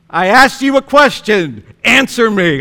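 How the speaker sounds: demanding wife. Retold or imitated. Elizabeth demanding an answer from mute Zechariah, sternly loud. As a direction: press him hard for an answer.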